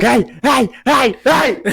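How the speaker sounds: high, repeated yelps of protest; mock protesting yells. To friend punching him in the ribs, yelling in protest. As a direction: cry out as if fending off punches.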